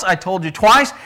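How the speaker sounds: ordinary delivery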